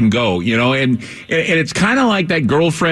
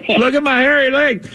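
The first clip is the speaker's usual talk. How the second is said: high-pitched mocking old-man impression; Joe Biden; 8 semitones higher than usual. Host mocking Biden's hairy legs story, high silly old-man voice. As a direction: pitch it up and stretch it like a daft boast. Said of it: "that's the difference with Biden and Trump".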